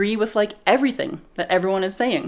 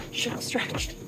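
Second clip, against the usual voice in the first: breathless whisper; 4 semitones higher, 9 dB quieter, slower than usual. Real, not acted. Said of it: "Calves are killing me".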